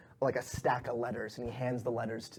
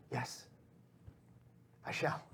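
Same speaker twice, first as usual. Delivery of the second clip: very soft, hushed servant; quiet, submissive reply; obedient pixie; 12 semitones lower, 12 dB quieter than usual. Acted. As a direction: answer the master almost in a whisper. Pixie answers its master.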